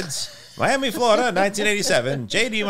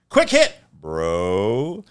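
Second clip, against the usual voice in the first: drawn out; 4 semitones lower, much slower than usual. Pretend. Drawn-out catchphrase.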